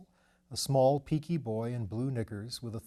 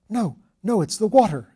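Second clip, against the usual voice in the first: high, loud, teeth chattering in fear; 10 semitones higher, 12 dB louder than usual. Acted. Shell-shocked lord hears water in the trench, teeth chattering. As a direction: shiver the words out. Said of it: "with chattering teeth".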